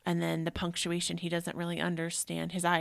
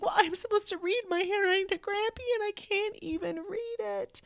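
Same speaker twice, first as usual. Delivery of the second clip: put-on high, teary child's voice; sobbing kid. Mother voicing her son sobbing about reading to Grampy, teary. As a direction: cry it out like a hurt boy. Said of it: "like, sobbing".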